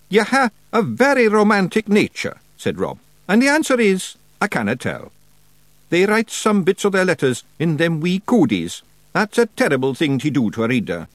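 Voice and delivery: high, loud broad Scottish wee man; a cocky little pixie laying down the law; Rob Anybody Feegle. A narrator's reading. Rob answers.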